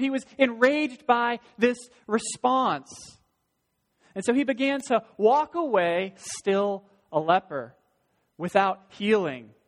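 loud, slow weighty pulpit voice; slow emphatic preaching. Acted. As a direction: let each word land heavily.

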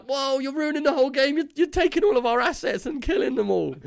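high, mock-outraged complaint; exasperated protest. Gamer scolds friend for wasting assets, high mock outrage. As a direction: whine that he's wrecking the whole game. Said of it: "And it was like".

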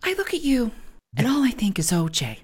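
Lisa Rinna mode. Retold or imitated. Co-host imitates Lisa Rinna insulting the newcomer, theatrical. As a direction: deliver the jab like a soap star. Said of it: "in full Lisa Rinna mode".

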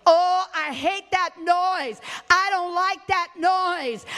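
sneering high mock complaint, drawn out; mocking the music hater. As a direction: mimic a grumbling churchgoer who hates the noise. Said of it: "You say".

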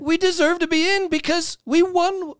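very high, strained protest; worked-up insistence. Real, not acted. Fan insisting his team deserves the spot, worked up. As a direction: pitch it way up and argue the case. Fans arguing their team is in.

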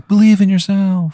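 put-on high, loud wizard voice with a hammy accent; Wizard of Baltimore. Wizard urging on the player fighting a shark, rousing call. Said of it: "the voice of the Wizard of Baltimore in".

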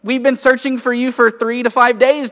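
high, loud, worried-sick scolding; exasperated parent scolding. Retold or imitated. Mary scolding her missing twelve-year-old son, loud and exasperated. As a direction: let the worried parent's relief burst out as a scold. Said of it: "it would go something like".

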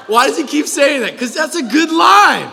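loud laughing disbelief. Real, not acted. Preacher to congregation, laughing loudly at the line.

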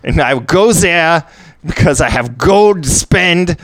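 German inventor. Acted. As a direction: stretch it out in a mock German accent. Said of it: "that was how I played him".

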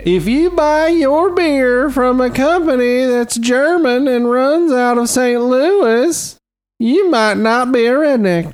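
comedian bit voice. Acted. Parody redneck catchphrase.

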